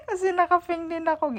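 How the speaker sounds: giggling at her own matching outfit; laughing. Real, not acted. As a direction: say it through real laughter, pitch jumping up.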